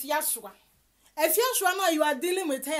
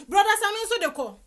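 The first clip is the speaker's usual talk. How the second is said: taunting call-out; 6 semitones higher, 6 dB louder than usual. Real, not acted. Taunting chant of the name.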